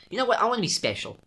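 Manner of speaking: high-pitched mock declaration; mock self-important. Voicing people who invented accents, mock self-important. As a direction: pipe up as someone craving attention.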